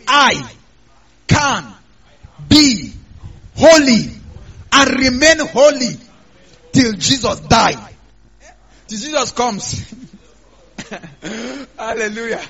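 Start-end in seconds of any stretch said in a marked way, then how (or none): 0.1-7.9 each word punched out loud, one by one; slow, loud, word-by-word declaration for the church to repeat; preacher leading a congregation chant, loud; call and response. Shown as a demonstration. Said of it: "Say it after me".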